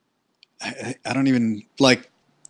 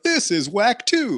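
higher, slow, scornful fan voice; mock fan complaint; 7 semitones higher, slower than usual. Fans reacting to Rebels, a slow mocking sneer. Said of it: "some people were gonna be like".

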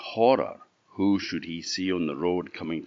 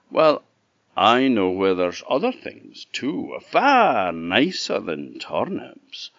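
coaxing, higher, louder wolf; sly wolf; 4 semitones higher, 6 dB louder, slower than usual. A narrator's reading. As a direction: tempt the pig sweetly. Wolf tempting the pig.

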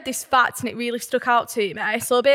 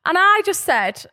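ordinary talk, nothing marked

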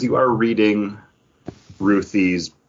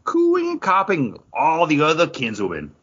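raised, theatrical read of a comic caption; dramatic reading; 6 semitones higher than usual. Shown as a demonstration. Podcast host reads a comic caption aloud, dramatic narration. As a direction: read it like a stage narrator, pitched up. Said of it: "that was a dramatic reading".

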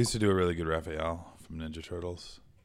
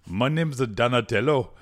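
loud, higher, badly faked Jamaican accent; botched Jamaican accent; 4 semitones higher, 13 dB louder than usual. Host improvises Donatello's accent, failed Jamaican attempt. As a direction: attempt a Jamaican lilt and miss, loudly. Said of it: "I was trying to do a Jamaican accent".

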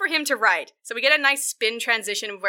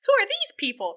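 high, mock-incredulous question; baffled reaction; 10 semitones higher than usual. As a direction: pitch it way up in disbelief.

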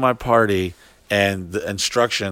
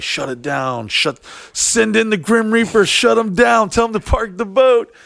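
retold urgent orders; 12 semitones higher, 3 dB louder than usual. Host ordering the party shut down, high urgent mock calling. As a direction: imitate frantic commands, high and raised.

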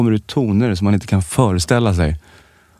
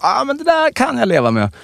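pitched way up, light mock resignation; high mock voice; 13 semitones higher than usual. Imitating his own reaction to the chips bag, much higher. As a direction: squeak it out like a shrugging inner voice. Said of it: "och man bara".

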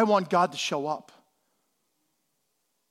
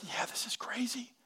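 hushed whisper, no voice; 2 semitones higher, 15 dB quieter than usual. Retold or imitated. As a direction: whisper it like a shared secret. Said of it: "He's like".

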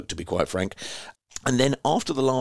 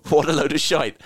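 mock indignant; 5 semitones higher, 5 dB louder than usual. Reading out a rude fan message.

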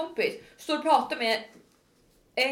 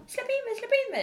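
high-pitched imitation of a begging crowd; mock pleading; 13 semitones higher than usual. Härmar folk som tigger i kön. (härmat tiggande med ljus röst)

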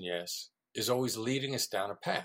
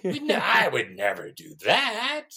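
mock outrage; 3 semitones higher, 6 dB louder than usual. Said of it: "they're gonna go".

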